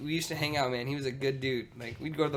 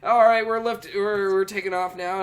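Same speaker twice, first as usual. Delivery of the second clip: raised, loud mock cabin announcement; lightly acted airline announcer, pitched up and projected; flight crew announcement; 8 semitones higher, 10 dB louder than usual. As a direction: imitate a pilot calling out takeoff. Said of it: "So they're like".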